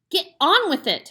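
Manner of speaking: high, loud hammed-up hiss; impatient hiss. A narrator's reading. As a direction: shove him off the sofa. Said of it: "hissed the mother".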